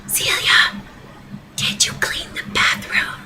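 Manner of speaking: whisper-calling down the hall; husband calling after his daughter in a whisper. Acted. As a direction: call out but only in a whisper. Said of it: "He's still whispering".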